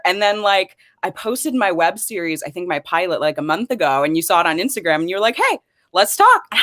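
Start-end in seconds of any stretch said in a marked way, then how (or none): 5.4-6.4 bright, much higher quoted invitation; excited quote. Retold or imitated. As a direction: retell the cheerful hey, let's talk. Retold friendly invitation quote.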